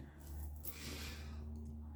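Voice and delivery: soft, drawn-out exhale; slow, voiceless breath to settle tears; long sigh. Real, not acted. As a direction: blow out a breath to stop crying. Trying to stop crying.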